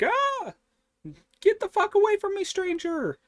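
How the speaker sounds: high, loud, fast panicked shriek at a stranger; startled scream. Retold or imitated. Bulma shrieking at the stranger holding her, panicked and loud. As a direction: shriek like a startled girl shoving someone off.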